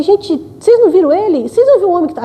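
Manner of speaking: desperate woman searching for the vanished man, frantic. Retold or imitated. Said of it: "Aí eu fiquei desesperada".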